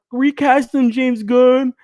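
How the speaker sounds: high, loud mock fan demand. Mocking fans who want Cavill back, loud and high. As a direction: whine it like an entitled fan. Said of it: "Everybody was like".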